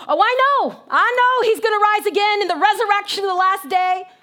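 loud, high, mock-pious know-it-all; real religious. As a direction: recite it impatiently, brushing the comfort aside. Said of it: "She gets real religious".

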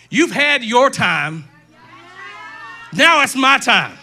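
loud, slow challenge to the devil; preacher's shout building in force; defiant declaration. Acted. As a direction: declare it loudly, claiming victory.

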